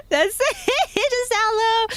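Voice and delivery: high, laughing through the words; helpless giggles breaking up the words. Real, not acted. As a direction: crack up while talking, voice shooting up. Laughing at own poor Russian.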